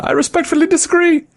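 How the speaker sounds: very high-pitched, quick cartoon yell; high falsetto drive-by heckle, hammed-up British; mock British yell. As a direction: call it out fast in a squeaky high British voice. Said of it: "the guy yells out".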